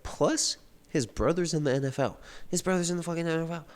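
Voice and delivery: hammed-up enthusiastic sales pitch; excited retold argument. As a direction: act out someone eagerly pleading a recruit's case. Retold dean arguing for the recruit.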